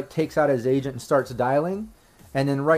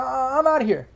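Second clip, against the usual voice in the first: high-pitched, agitated rambling; rambling drunk guy; 11 semitones higher than usual. Acted. Drunk guy behind the gunman rambles on, high and agitated. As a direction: ramble on in a raised, rattled drunk voice. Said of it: "like, rambles on".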